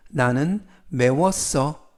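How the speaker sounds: ordinary talk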